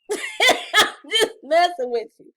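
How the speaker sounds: laughing through the words, slowed down. Real, not acted. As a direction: laugh it off while you tease them. Teasing the viewers.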